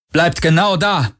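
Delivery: high-pitched barked order; an order meant to carry; commanding call. A narrator's reading. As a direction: call it out sharply across a platform. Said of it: "Parkers Stimme hallte über die Plattform".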